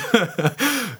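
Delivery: bright burst of laughter; high laugh. Real, not acted. Laughing at own joke with the host.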